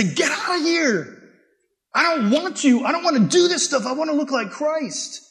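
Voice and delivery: high, forceful telling sin to get out; defiant shout. Acted. Believer shouting at sin to get out, defiant and high. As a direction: push it away with a ringing cry.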